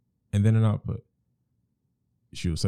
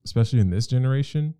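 ordinary talk, nothing marked

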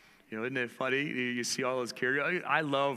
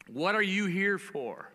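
preacher's question; 7 semitones higher than usual. Shown as a demonstration. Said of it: "here's a question before the house today".

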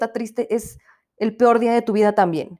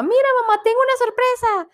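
put-on high, excited kid showing off a surprise; squeaky child presenting his treasure; little boy's voice; 13 semitones higher than usual. Boy shows his mom a butterfly, high childlike voice. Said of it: "le dice a la mamá".

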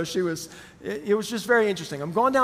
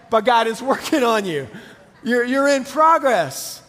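chuckling, a bit louder and slowed; laughing through it; 3 semitones higher, 5 dB louder than usual. Real, not acted. Pastor laughing at his own meme joke to the church. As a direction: laugh the words out as the joke lands.

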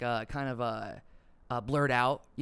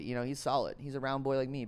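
ordinary talk

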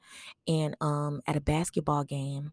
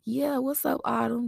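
imitating a playful girl; 9 semitones higher than usual. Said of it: "she was like".

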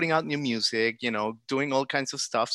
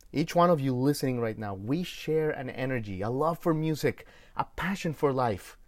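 ordinary talk, nothing marked

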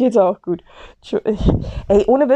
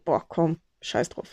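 muttering; 5 semitones lower, 9 dB quieter than usual. Retold or imitated. Her usual eye-rolling thought.

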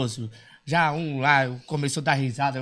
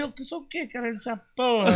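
high indignant question; mock angry retort; 8 semitones higher than usual. Dwarf retorts to the friend, high offended. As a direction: demand it, offended.

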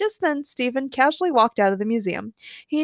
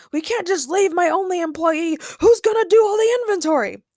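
high-pitched, frantic wail; screamed complaint; 9 semitones higher, 4 dB louder than usual. A narrator's reading. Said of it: "screamed Ray".

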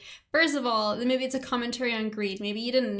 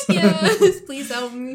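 laughing, half-crying mock plea; 8 dB louder, much slower than usual. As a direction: beg for help as a joke through laughter.

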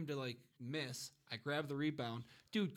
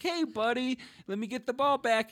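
put-on high, louder friendly call to a kid; talking to little kids; 8 semitones higher, 4 dB louder than usual. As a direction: pitch it up as if addressing a ten-year-old. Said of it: "like they're regular ten-year-olds".

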